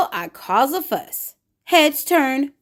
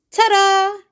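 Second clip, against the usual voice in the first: showy ta-da; 7 semitones higher, 4 dB louder than usual. Acted. Showy self reveal.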